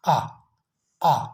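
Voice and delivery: आ drawn out; vowel demo. Shown as a demonstration. Teacher demonstrating the vowel in jack. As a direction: say आ slowly for the learners. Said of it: "ये sound है".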